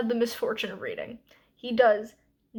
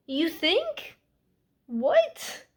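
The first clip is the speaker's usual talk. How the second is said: higher-pitched, rising mock disbelief; incredulous; 5 semitones higher, much slower than usual. Real, not acted. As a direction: scoff at how obvious it is. Mocking the narrator's late guilt.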